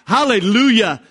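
loud, high shout of praise; multitude's triumphant hallelujah; crying out. Acted. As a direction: ring out the cry over the crowd. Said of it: "crying out".

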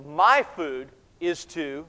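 "My" drawn out slowly and emphatically; stress on My. Shown as a demonstration. Preacher shows the Greek emphasis, stress on My. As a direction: lean slowly on the word "My". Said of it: "these are all emphatic".

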